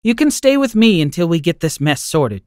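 grandma welcoming her grandson in; tender grandma. Acted. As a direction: comfort him like a kind old grandmother. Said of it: "she said".

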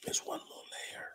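hushed secretive whisper; conspiratorial whisper. Acted. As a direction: lean in and whisper it. Repeats line in a whisper.

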